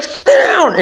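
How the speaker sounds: very high urgent order; retold yell, pitched far up; yelling at him. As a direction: shriek it high to make him duck. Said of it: "I'm yelling at him".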